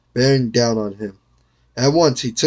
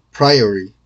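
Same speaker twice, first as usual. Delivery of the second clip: plain reading voice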